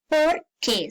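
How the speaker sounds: POR and QUÉ split apart, each stressed; two separate words. Shown as a demonstration. Teacher showing students the direct question form, POR and QUÉ separated. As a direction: say POR, then a distinct QUÉ. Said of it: "separado y con tilde".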